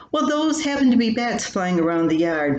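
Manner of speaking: ordinary delivery, nothing marked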